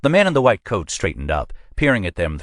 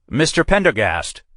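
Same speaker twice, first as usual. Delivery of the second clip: higher call after him; 9 semitones higher than usual. A narrator's reading. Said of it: "Captain Custer called".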